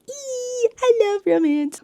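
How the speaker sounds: high, loud fangirl squeal; delighted squeal. Real, not acted. Fan gushing over her ship sailing, high squeal. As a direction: squeal with joy over romance.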